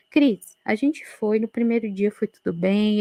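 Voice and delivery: ordinary talk, nothing marked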